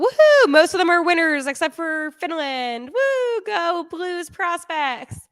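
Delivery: excited cheering. Real, not acted. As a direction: cheer them on like a fan in the stands. Cheering on her team's prospects.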